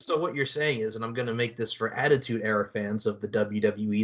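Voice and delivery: ordinary talk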